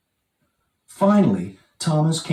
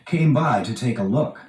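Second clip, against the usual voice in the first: ordinary delivery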